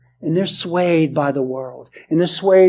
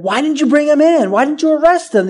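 higher, demanding Pharisee voice; indignant Pharisees; 8 semitones higher than usual. A narrator's reading. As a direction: scold the officers for coming back empty-handed. Pharisees scolding officers, scripture voiced.

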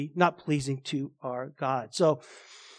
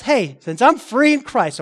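slow, raised mock antinomian declaration; imitating an antinomian; 5 semitones higher, 6 dB louder than usual. Antinomian claiming freedom in Christ, slightly hammed-up and loud. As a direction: lightly play the antinomian, drawn out and louder. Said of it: "the antinomian says".

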